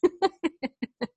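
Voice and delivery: quick high giggle; amused giggle; short giggle. Real, not acted. Laughing, teasing student.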